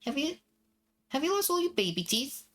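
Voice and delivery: put-on high childlike question; little girl voice. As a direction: play the curious little sister. Little sister asks Anton.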